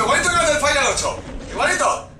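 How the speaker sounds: high-pitched, gushing delight; fan excitement. Real, not acted. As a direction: gush in amazement at the resemblance. Fan asombrado ante el bicho. (emoción aguda de fan)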